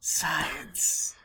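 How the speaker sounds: breathy whispered word into an ear; sweet nothings whisper. Retold or imitated. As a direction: whisper it like a lover. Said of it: "whispers sweet nothings into my ear".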